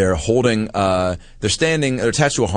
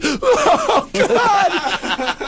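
very high, panicked laughing shriek; terrified shriek. Retold or imitated. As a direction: shriek like someone falling. Terrified woman as platform drops.

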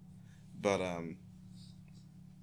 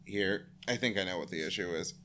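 usual speaking voice

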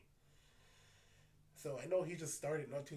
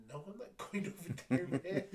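half laughing, refuse to go; laughing refusal; 5 semitones higher, 8 dB louder than usual. Retold or imitated. Retelling his refusal to the partner, laughing and louder. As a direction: laugh through a slightly higher, louder no.

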